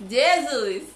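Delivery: sudden loud burst; exasperated exclamation. Real, not acted. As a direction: cry it out in mock horror. Exclamação depois do desabafo da TPM. (exclamação alta de espanto)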